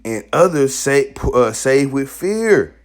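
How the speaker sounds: loud, slow, high preaching; slow thundering sermon read; forceful scripture reading. Acted. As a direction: proclaim the verse with weight. Reads scripture at full force.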